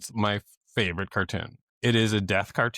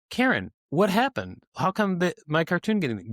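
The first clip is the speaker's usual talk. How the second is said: puzzled; 8 semitones higher than usual. Retold or imitated. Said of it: "And I was like".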